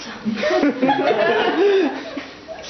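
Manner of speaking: high amused father's laugh; hearty laugh. Acted. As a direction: laugh big at your own joke.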